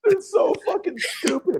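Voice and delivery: high laughing cry, slow; real laughing outburst; laughing exasperation. Real, not acted. Laughing exasperation at Morbius re-release.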